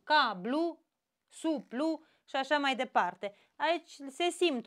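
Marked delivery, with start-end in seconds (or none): none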